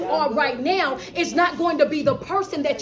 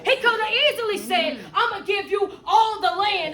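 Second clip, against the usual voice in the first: higher-pitched, driving sermon delivery; fervent preaching; 4 semitones higher than usual. Real, not acted. Coach preaching that life requires a brand new you, fervent.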